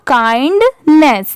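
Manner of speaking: slow kindness, split "kind... ness"; word in two parts. Shown as a demonstration. Teacher puts the halves together for pupils, slow and split. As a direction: say kindness slowly, pausing after KIND. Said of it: "तो पूरा क्या हो जाएगा?".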